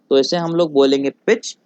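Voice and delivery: ordinary delivery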